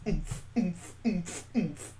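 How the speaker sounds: usual speaking voice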